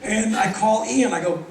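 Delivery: normal talking voice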